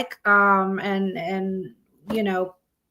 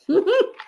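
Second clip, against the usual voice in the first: high giggle; 12 semitones higher, 3 dB louder than usual. Real, not acted. Laughing at herself.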